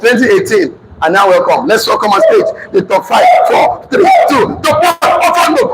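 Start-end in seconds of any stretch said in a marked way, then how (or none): 1.7-5.7 high, shouted award-show announcement; stage announcer. Retold or imitated. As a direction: announce the winners to a roaring hall.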